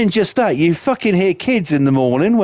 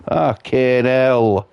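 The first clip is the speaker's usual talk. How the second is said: normal talking voice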